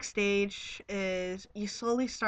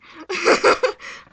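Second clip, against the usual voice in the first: higher, louder burst of laughter; 10 semitones higher, 14 dB louder than usual. Real, not acted. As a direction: break out laughing. Laughs at the irony.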